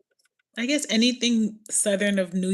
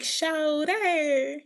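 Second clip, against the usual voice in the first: high, drawn-out Carolina twang in imitation; Carolina accent; 8 semitones higher than usual. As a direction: mimic a Carolina accent, stretching the word. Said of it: "You got an accent and everything".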